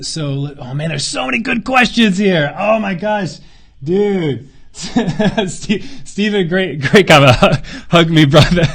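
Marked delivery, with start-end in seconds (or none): 3.8-8.8 laughing through the words, loud and high. Real, not acted. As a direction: gush at a fan between laughs. Laughing at a listener's kind comment.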